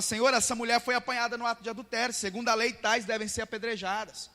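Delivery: pressing the charge against her; accusing crowd. Retold or imitated. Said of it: "De repente eles falam".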